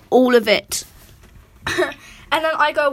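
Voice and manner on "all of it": blunt retort. Retold or imitated. Reads out ex's blunt reply.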